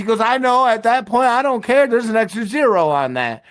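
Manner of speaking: shrill-sounding mom dismissing him; high mock mom; imitating mom. Mom voiced in story.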